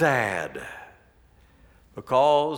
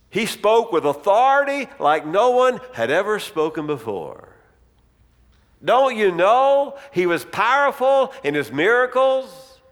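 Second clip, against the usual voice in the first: exasperated telling; 6 semitones higher, 5 dB louder than usual. Acted. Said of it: "Cleopas is telling Jesus the story".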